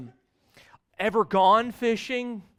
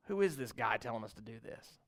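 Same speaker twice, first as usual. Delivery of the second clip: grumbling aside; 7 semitones lower, 9 dB quieter than usual. Pretend. Imagined grumbling of the disciples.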